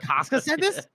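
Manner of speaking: high-pitched incredulous question; mock disbelief. Temple can't believe who said it, acted incredulous. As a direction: shoot the pitch up in retold astonishment.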